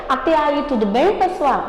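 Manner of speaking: ordinary delivery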